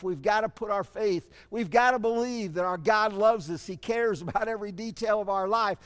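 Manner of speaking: ordinary talk, nothing marked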